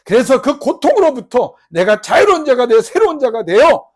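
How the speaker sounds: loud, high, slow pleading; crying out in prayer. Acted. Jabez crying out to God, loud and pleading. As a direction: pour a lifetime of grief into the plea. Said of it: "한해 맺힌 기도를 맨날 했다는 거예요".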